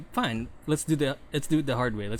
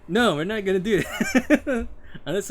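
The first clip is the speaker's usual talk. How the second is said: laughing; 8 semitones higher, 6 dB louder than usual. Real, not acted. Laughing mid-sentence.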